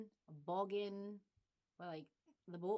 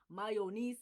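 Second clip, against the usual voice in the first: "mayonnaise" in a Ghanaian accent; 5 dB louder than usual. Shown as a demonstration. Admitting her slip on a word, Ghanaian accent. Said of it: "my Ghanaian accent comes in".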